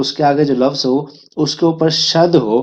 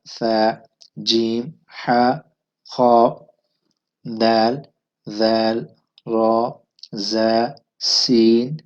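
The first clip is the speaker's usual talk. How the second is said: slow, careful letter-by-letter recital; alphabet recitation; 2 semitones lower, much slower than usual. Shown as a demonstration. Teacher reciting the alphabet to students, slow and careful. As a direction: sound out each Arabic letter clearly. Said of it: "reciting the Arabic alphabet".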